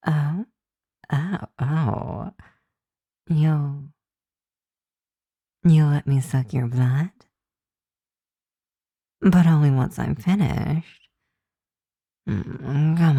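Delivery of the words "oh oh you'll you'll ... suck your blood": ordinary talk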